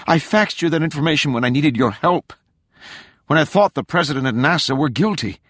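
high, urgent self-defence; heated, insistent daughter; indignant protest. A narrator's reading. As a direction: push back against the accusation. Daughter protests father's accusation.

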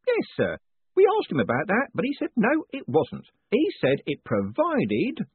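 a beaming prison warden teasing his prisoner; jovial warden. A narrator's reading. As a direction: play the cheerful warden gloating kindly. Warden reports.

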